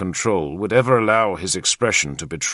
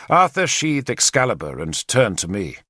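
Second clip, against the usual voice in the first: ordinary delivery, nothing marked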